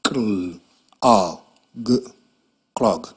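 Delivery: segment the sounds of "clog" one by one; sounding out words. Shown as a demonstration.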